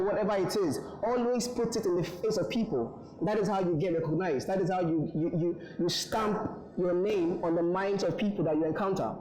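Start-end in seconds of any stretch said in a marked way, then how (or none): none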